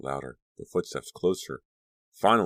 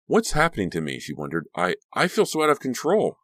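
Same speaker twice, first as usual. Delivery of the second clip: panicked; 7 semitones higher, 3 dB louder than usual. Acted. Danielle panics at her rage.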